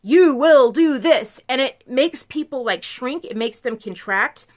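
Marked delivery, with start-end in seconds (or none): 0.0-1.4 loud, slow, domineering order; commanding tone forty. Retold or imitated. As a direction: imitate a controlling command, each word weighted. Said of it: "tone forty of".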